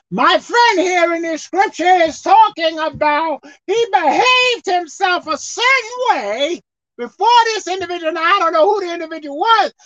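building sermon delivery; preaching. Acted. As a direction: preach it to the congregation.

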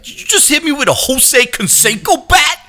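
high-pitched, incredulous character voice; Raphael movie quote. Acted. Raphael to Casey Jones mid-fight, high incredulous put-on voice. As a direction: quote it as an outraged, put-on cartoon hero. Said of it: "my favorite line from one of the movies is when Raphael's".

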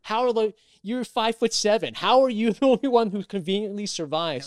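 high, loud mock accusation; hammed-up incredulous accuser; incredulous challenge. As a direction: challenge them in loud disbelief, half laughing. Acts out suspicious people's challenge.

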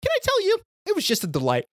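high, gushing delight. Retold or imitated. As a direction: gush over it with breathless joy. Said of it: "I was like".

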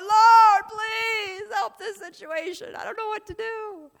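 high, loud, tearful plea to the Lord; crying prayer. Retold or imitated. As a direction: cry out to God in desperation. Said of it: "There's been a few nights where I'm like".

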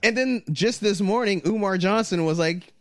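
ordinary talk, nothing marked

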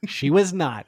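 loud, fast and thrilled; excited. Real, not acted. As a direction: gush about it loudly. Dramatic recap of wedding.

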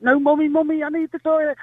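high-pitched mock child plea; insistent little daughter. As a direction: imitate a child pestering Mummy. Daughter pleading more insistently.

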